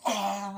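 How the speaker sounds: high, loud anguished cry; emotional gasp. Retold or imitated. Seeing his new face, an anguished cry. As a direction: cry out in shock at your own face. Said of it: "he's emotional when he sees himself".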